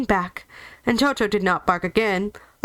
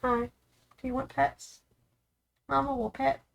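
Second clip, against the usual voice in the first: much softer, cooing to a cat; soft pet talk; 3 semitones higher, 8 dB quieter than usual. Real, not acted. Owner offers her cat pets, softly cooing. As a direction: drop to a hushed, tender offer.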